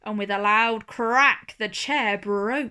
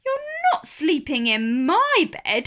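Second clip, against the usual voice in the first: higher, louder middle bear; medium bear voice; 5 semitones higher, 5 dB louder than usual. A narrator's reading. Medium bear tells Mister Lazy off, high voiced. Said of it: "said the medium bear".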